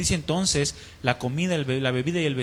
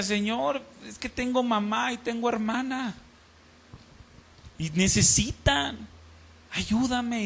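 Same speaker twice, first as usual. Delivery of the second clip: llorando; 6 semitones higher, slower than usual. Retold or imitated. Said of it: "me ponía a llorar y le decía".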